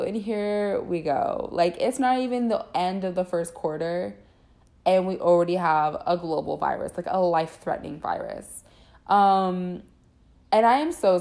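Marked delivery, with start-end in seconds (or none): none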